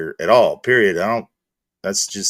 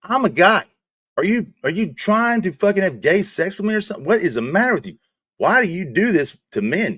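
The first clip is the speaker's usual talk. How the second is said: exasperated; 8 semitones higher than usual. Retold or imitated. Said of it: "I'm like".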